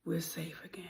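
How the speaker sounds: very soft whisper; low, whispered aside; hushed relief. Acted. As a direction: breathe it out in a whisper. Relieved aside after escape.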